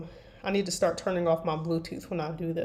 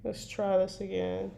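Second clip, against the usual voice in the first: ordinary talk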